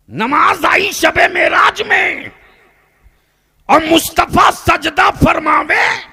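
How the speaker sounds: high shouted proclamation; full-force preaching. Real, not acted. Preacher tells the congregation of the Prophet's prostration, full-force preaching. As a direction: ring it out loud over the crowd.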